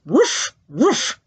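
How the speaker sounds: two imitated dog barks; short teacher's doggy woofs. Shown as a demonstration. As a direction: bark twice like a dog. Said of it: "they say".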